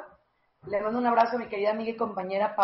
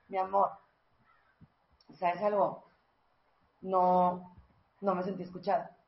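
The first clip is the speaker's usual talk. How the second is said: soft lowered voice, the right way; low calm tone; 3 semitones lower, 5 dB quieter than usual. Shown as a demonstration. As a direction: speak low and calm to your husband. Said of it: "Hablas un poquito así como".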